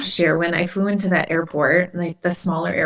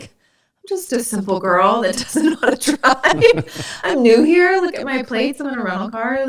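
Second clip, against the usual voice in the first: put-on high, sing-song clueless girl act; hammed-up sweet helpless voice; playing the innocent; 7 semitones higher, 3 dB louder than usual. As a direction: plead innocence through a smile, laughing at yourself. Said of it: "I just have this smile, like".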